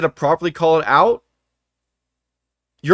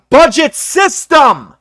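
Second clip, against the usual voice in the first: "budget system" slow, loud and higher, each word hammered; emphatic punchline; 8 semitones higher, 6 dB louder, much slower than usual. Real, not acted. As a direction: slam the phrase home. Ranting about fanboys' hypocrisy.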